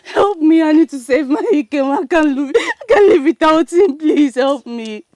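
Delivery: tearful, desperate begging; crying. Acted. Woman begs Ugochi to help save Ikem, crying. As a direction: plead for help through sobs. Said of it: "You have to stop crying".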